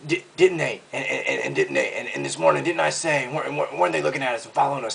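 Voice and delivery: stammering, rattled imitation of panic; hammed-up paranoid stutter; mock freak-out. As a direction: trip over the words in mock paranoia. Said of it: "Alex was in full freak mood".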